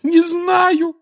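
put-on very high, frightened girl's shriek; piercingly high, scared cry; terrified squeal. A narrator's reading. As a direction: squeal it out in panic. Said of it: "взвизгнула насмерть перепуганная Гермиона".

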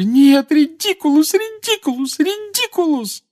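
high wailing spell, over and over; mother failing to banish the boggart; desperate repeated spell. A narrator's reading. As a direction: fling the spell again and again in panic.

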